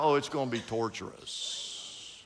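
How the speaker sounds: normal talking voice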